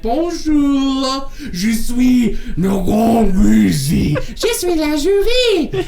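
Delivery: high, slow, hammed-up French accent; mock French accent. Mocking the game character's funny French accent. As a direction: parody a silly French accent. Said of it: "she got a funny French accent".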